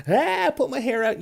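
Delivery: high, loud hammed-up panic cry; mock freak-out. Imitating the panic he avoided with his producer, mock freak-out. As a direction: squeal it like a mock meltdown. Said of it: "You didn't go, like".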